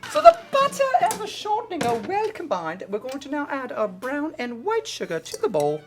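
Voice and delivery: a lightly put-on British accent, chatty baking host; mock British cook, cheerful and hammed-up; British accent, light and jokey. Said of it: "why am I still talking like this".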